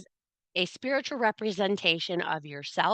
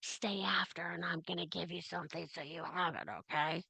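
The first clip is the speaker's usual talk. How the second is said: hushed, secretive whisper; a soft conspiratorial stage whisper; whispered aside; 11 dB quieter than usual. Acted. Confiding in a viewer after class.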